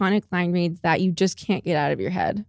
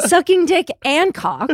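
quoted line; 7 semitones higher than usual. Retold or imitated. Quoting a YouTube apology.

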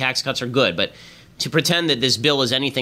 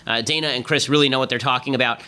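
ordinary delivery

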